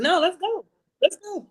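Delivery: high, eager rush; pushy excited voice. Retold or imitated. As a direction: urge it on impatiently. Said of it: "And you are like".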